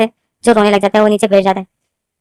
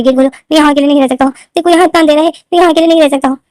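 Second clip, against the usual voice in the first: crying plea; 6 semitones higher than usual. A narrator's reading. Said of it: "और रो रहा होता है कि".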